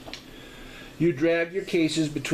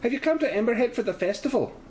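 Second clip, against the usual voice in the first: put-on higher, faster landlady in a mixed Irish-Scottish accent; 12 semitones higher, faster than usual. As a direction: blend Irish and Scottish lilt. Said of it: "half Irish, half Scottish".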